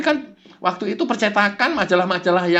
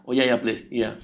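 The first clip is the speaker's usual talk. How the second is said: much lower, softer, submissive; meek low yes-man; 8 semitones lower, 4 dB quieter than usual. Acted. Follower meekly gives in to the devil. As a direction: give in to the devil quietly.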